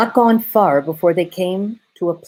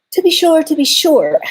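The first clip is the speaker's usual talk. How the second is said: much higher, louder flustered fawn; 12 semitones higher, 6 dB louder than usual. A narrator's reading. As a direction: fluster it out, high and quick.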